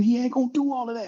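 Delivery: hushed but heated, quick; whisper yelling. Real, not acted. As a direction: yell under your breath. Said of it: "I'm whisper yelling now".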